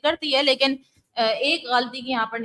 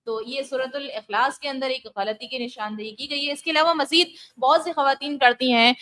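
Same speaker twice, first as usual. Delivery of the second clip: normal talking voice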